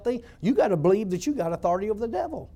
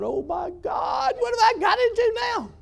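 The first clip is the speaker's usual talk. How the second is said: scared outcry; 13 semitones higher than usual. Retold or imitated. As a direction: cry out in sudden fright. Scared facing the demon.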